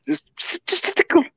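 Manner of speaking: very high squeaky just a tickle; mock squeaky voice shrugging off a cough. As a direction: squeak it out like a cartoon. Joking about his cough.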